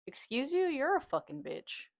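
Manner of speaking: high, mock-offended clapback; indignant retort, retold. Woman retells confronting rude passenger, indignant.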